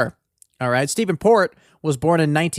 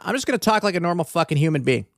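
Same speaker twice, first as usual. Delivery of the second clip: ordinary talk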